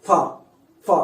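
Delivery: ఫ blown out with force; blown-out sound. Shown as a demonstration. Teacher demonstrates saying it by blowing, blown-out sound. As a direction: push air between lip and teeth on each ఫ. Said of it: "ఊది చెప్పడం".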